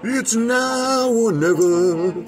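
slow, loud, high mock-heroic proclamation; hammed-up battle cry, drawn out; dramatic declaration. Dramatic reply to child.

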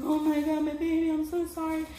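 high-pitched imitation of a crying mother; retold tearful cry. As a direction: mimic a mother wailing over her child, voice lifted. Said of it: "She's crying and she's like".

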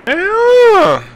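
high squeal of delight; excited yes. Real, not acted. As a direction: squeal it out in joy. Reaction to the volley.

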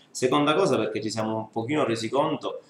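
usual speaking voice

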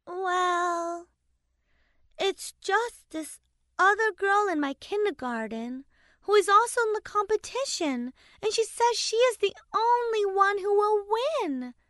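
put-on high child voice, unsure and halting; a little girl admitting what's bothering her; hesitant little girl. A narrator's reading. Daughter confessing to her father, a hesitant high child voice. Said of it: "Haley said hesitantly".

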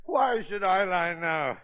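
usual speaking voice

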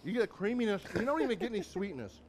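talking through a mouthful; cheeks stuffed full. Real, not acted. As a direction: chew and talk at once. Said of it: "Don't talk with your mouth full".

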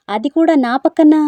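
loud, high startled cry; shocked shriek on waking; shocked yell. Acted. Waking up beside him, shocked.

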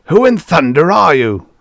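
loud, high roar. A narrator's reading. General challenging the stranger by the car, roaring. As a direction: roar the demand at him. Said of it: "roared the general".

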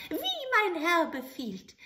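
high, eager little goblin voice; bow and ask for orders; little grey man. Acted. Grey manikin appearing to the soldier, eager.

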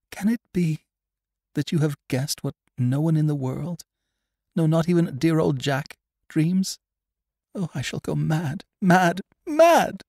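high, distraught voice building to a cry; desperate, high-pitched disbelief turning frantic; frantic anguish. A narrator's reading. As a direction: break into hysteria, loudest at the end. Terrified woman on verge of madness.

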